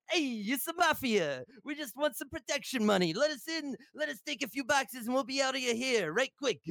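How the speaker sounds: a hint of a goofy mobster asking for protection money; silly mafia roleplay. Raiders demanding protection money from the base, mild mock mobster. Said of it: "do a silly roleplay".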